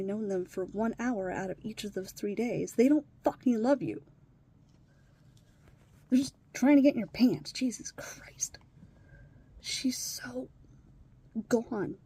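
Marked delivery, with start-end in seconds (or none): 2.7-7.5 loud, higher angry shout; angry outburst. Real, not acted. Ranting about men who prey on her, loud anger. 7.5-10.5 slow, soft whisper; whispered exasperation. Real, not acted. Exasperated aside about the stepdaughter, whispered.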